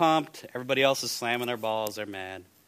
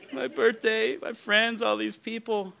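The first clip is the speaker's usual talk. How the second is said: put-on higher, tearful little-girl voice; imitating a crying girl; 11 semitones higher, 4 dB louder than usual. Pastor retells the girl crying at camp, high tearful voice. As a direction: play the sobbing child, high. Repeating the girl's words.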